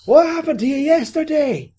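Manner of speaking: very high, loud acted question; mock incredulous shout. Podcaster mocking a film character's choice, high incredulous shout. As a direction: cry it out in exaggerated disbelief.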